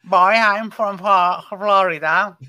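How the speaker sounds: slow, stretched Floridian accent; attempted Florida accent, taking it slowly. Shown as a demonstration. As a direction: put on a drawn-out Florida accent. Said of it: "Extremely legit".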